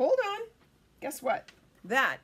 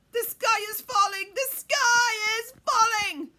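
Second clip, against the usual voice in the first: high, loud alarm, stretched at the end; panicked mock cry; 11 semitones higher, 12 dB louder than usual. Chicken Little warning everyone after the acorn, high mock-panic shout.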